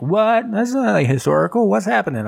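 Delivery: high-pitched incredulous protest; hammed-up confusion, pitch way up; mock bafflement. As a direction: act out a bewildered viewer. Acting out a baffled viewer.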